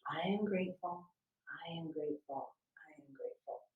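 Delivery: gentle mantra sinking to a whisper; fading to whisper. Shown as a demonstration. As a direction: let the phrase dissolve into a whisper. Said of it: "bring your attention back to that repetition".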